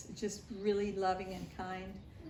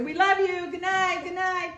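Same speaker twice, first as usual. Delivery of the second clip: yelling good night; 11 semitones higher, 8 dB louder than usual. Retold or imitated. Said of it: "would be yelling at each other".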